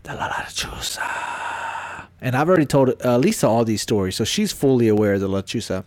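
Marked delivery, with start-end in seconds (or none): 0.0-2.1 slow, hushed mock dread; joking spooky whisper. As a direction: whisper it as a stretched-out scare joke. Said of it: "And as a joke, I go".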